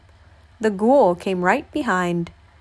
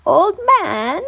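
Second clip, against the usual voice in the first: put-on old woman; 10 semitones higher, 5 dB louder than usual. A narrator's reading. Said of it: "said the old woman".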